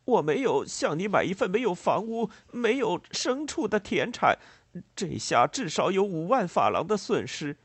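high-pitched, heated farmer voice; aggrieved protest. A narrator's reading. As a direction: argue the loss indignantly. Angry farmer argues over his loss. (激动愤懑地高声争辩)

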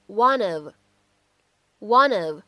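"one of" linked into one smooth sound; "one of" said as "wunnuv"; linking. Shown as a demonstration. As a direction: run the n of "one" into "of". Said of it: "可以读成".

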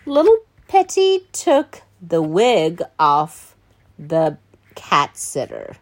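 ordinary delivery, nothing marked

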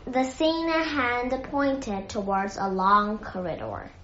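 plain reading voice

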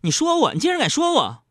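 high, affronted retort; sudden hostility. Retold or imitated. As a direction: turn on him in mock indignation. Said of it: "他居然瞬间翻脸说".

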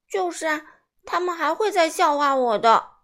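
loud complaint. A narrator's reading. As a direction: protest loudly that they'll laugh again. Simon upset. (西蒙激动地大声抱怨)

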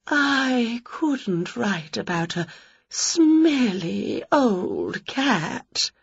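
slow, soft, near tears; a fussy ghost, tearful and snobbish; sniffy ghost. Acted. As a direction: whimper it in disdain. Ghost disgusted.

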